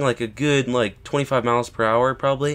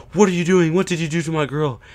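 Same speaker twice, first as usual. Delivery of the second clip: high, raised mock-angry outburst; imitated pissed-off boyfriend; 6 semitones higher than usual. Boyfriend confronting the skier who hit his girlfriend, mock angry. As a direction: act out an angry confrontation, pitched up. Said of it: "he was all pissed".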